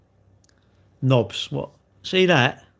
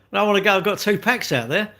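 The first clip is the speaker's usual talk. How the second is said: whiny mock protest; 7 semitones higher, 4 dB louder, faster than usual. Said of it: "I'm like".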